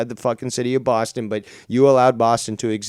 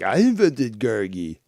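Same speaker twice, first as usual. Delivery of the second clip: slow, mocking impression of a boaster; mocking imitation; 3 semitones lower, slower than usual. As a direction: drag it out to mock his claim. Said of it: "And then he was like".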